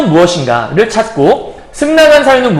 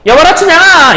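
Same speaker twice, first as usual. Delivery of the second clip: higher, louder, yelling the name across the yard; wrong shouted call; 8 semitones higher, 5 dB louder than usual. Shown as a demonstration. Teacher demonstrating the wrong way to call Chunhyang, loud shout. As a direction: bellow 춘향아 like a shout across a field. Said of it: "이렇게 얘기하면 좀 이상하잖아요".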